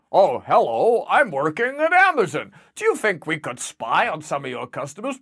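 put-on American sitcom voice; American accent. CIA man chatting to his Amazon double, hammed-up American accent. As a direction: play a moustached American official in a spoof. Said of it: "I like your American accent".

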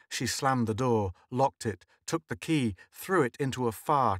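plain reading voice

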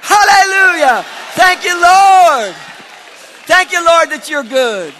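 high, loud shouts of thanksgiving; shouted praise. Real, not acted. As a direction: cry out thanks at full force.

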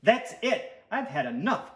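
loud exasperated outburst; fed-up cry. A narrator's reading. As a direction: cry out at the end of your patience. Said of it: "cried Stuart".

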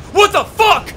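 startled shout. Real, not acted. Sudden dark door scare.

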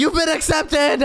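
slow high shouted announcement; announcer shout. Acted. Host announces the new dance partner, shouting. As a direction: proclaim it like a game-show host.

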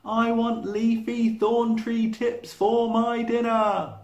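high, loud, slow shout; yelling. Acted. Giraffe shouting his dinner order to the plain, loud slow bellow. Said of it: "yelled Giraffe".

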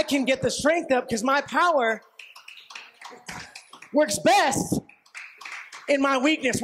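rising, fervent preaching shouts. Acted.

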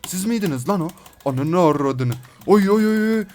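loud, high, excited shouts; excited discovery. Real, not acted. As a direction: burst out as you spot the enemy. Spots the enemies he was hunting.

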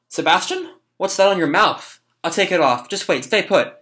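voiced young boy. Boy talking to muzzled Sebastian, voiced young boy. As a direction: read the boy's part, lifted in pitch. Reading boy's lines to Sebastian.

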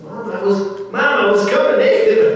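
high, loud excuse to Mom; protesting son. Retold or imitated. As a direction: imitate a son whining his defence. Son voiced protesting to his mother.

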